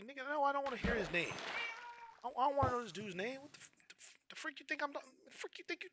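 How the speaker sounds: high, indignant mock outrage; outraged retort. Retelling his reply to girlfriend, high mock outrage. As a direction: fire back at her in disbelief.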